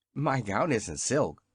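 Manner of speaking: plain reading voice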